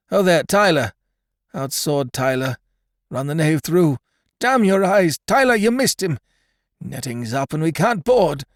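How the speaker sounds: high, loud raving commands; delirious dying pirate shouting at Tyler; raving delirium. Acted. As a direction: rave as if back in a sea fight. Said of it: "For a moment he raved".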